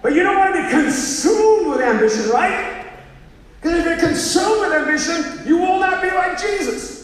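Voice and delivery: high, loud moralizing; hammed-up preachy caution; mock stern warning. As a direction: hammer it home like an alarmed moralizer. Voicing an overblown moral warning.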